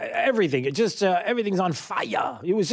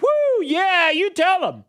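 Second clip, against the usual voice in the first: mock cheering; 4 dB louder, slower than usual. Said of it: "everybody would be like".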